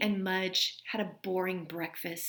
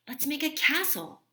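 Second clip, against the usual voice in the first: higher, louder cheerful mom; higher mom's voice, delighted; mother's voice; 7 semitones higher, 4 dB louder than usual. A narrator's reading. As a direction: pitch the idea like a fun mom. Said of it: "said Henry's mother".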